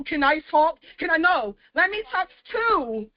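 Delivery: ordinary talk, nothing marked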